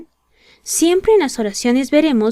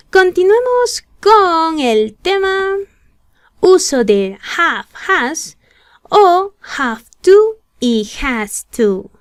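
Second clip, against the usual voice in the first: announcing topic; 7 semitones higher, 3 dB louder, slower than usual. Real, not acted. Announcing new lesson topic.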